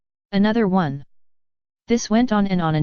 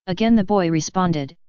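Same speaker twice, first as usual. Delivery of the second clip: ordinary talk, nothing marked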